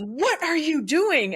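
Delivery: secretary catching a stranger at the copier, mock outrage; slow indignant demand. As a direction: drag it out, outraged and suspicious. Secretary confronts newcomer, retold.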